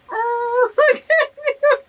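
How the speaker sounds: high squeal breaking into a teary laugh. Real, not acted. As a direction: coo at the picture. Gushing at the picture.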